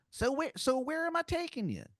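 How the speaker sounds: a cartoon Philly guy bragging about his snacks; awful Philadelphia accent. Wizard driver offering hoagies in a terrible Philadelphia accent. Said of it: "the worst Philadelphia accent".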